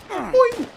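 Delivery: high, loud, springy boing; "boing" jump noise. Real, not acted. Gamer adding a sound effect to play, high boing. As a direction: squeal a springy cartoon boing.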